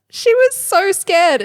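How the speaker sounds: slow, high and tearful; slow teary high. Real, not acted. Mum near tears over her scared daughter, slow and high. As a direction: let the voice wobble up high with pride. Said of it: "You feel all the emotions?".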